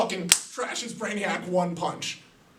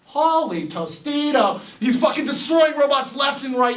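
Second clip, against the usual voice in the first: hyped-up; 7 semitones higher than usual. Acted. Narrator thrilled by the robot fight, high and shouting. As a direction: pump it up like a fanboy.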